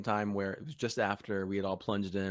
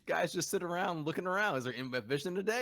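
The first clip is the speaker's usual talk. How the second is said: high, breezy imitation of a casual guy; tossing it off like it's nothing, mimicking him; 8 semitones higher than usual. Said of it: "He said it so casually".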